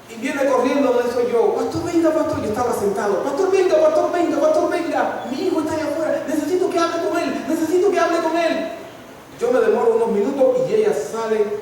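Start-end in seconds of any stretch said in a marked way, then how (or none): 1.5-8.7 much higher, urgent calling for the pastor; a frantic mother begging the pastor to come; urgent pleading. Retold or imitated. Madre desesperada llama al pastor. (madre desesperada, voz aguda y urgente)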